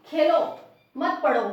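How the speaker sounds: Khelo punched loud and high, break, मत पढ़ो; pause after Khelo. Shown as a demonstration. Teacher telling them to play, not study, pause after Khelo. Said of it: "यहाँ पे थोड़ा सा रुकना पड़ेगा".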